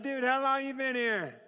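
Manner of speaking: speaking to a fellow American prisoner, excited; excited, laughing question. Retold or imitated. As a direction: ask it thrilled to find a countryman.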